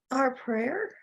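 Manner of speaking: usual speaking voice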